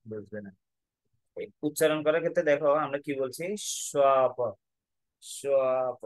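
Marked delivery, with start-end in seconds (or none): 3.9-4.4 loud, lengthened vowel in স্বপ; স্বপ drawn out. Shown as a demonstration. Teacher showing pupils the dash, স্বপ held long. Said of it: "একটু করে টান দিচ্ছি". 5.2-6.0 lengthened vowel in স্বপ; স্বপ drawn out. Shown as a demonstration. Teacher repeating the example for pupils, স্বপ stretched. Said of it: "একটু করে টান দিচ্ছি".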